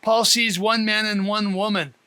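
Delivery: usual speaking voice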